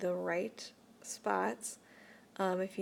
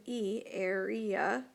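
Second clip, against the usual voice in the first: "E, area" said slowly, leaning on the long E sound; stress on the long E; much slower than usual. Shown as a demonstration. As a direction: stretch out "E" and then "area" so the long vowel rings. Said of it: "that long vowel sound that comes from the".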